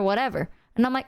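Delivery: usual speaking voice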